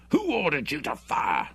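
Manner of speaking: hoarse with anger. A narrator's reading. Bruce confronts Hendry over the shooting, angry. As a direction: challenge him, voice tight with anger. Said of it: "Bruce's voice was also hoarse, but with anger".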